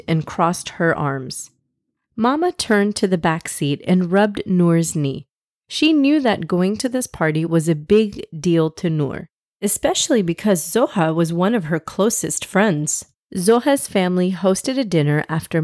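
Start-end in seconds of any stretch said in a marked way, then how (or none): none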